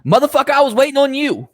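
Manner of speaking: high, loud outburst; exasperated yell. Retold or imitated. Retelling angry inner reaction.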